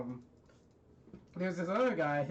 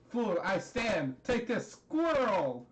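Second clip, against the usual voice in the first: high, slow, hammed-up challenge; mock battle shout; 5 semitones higher, 4 dB louder, slower than usual. Character attacks his foe, hammed-up battle shout. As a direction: build to a drawn-out, high cry.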